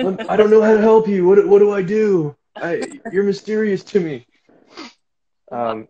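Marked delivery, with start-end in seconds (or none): 0.0-4.3 high, loud, frantic concern; hammed-up panicked boyfriend; mock panic. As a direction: plead helplessly with someone in tears. Acting himself baffled by crying girlfriend.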